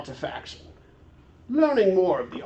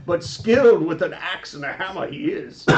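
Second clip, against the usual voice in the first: usual speaking voice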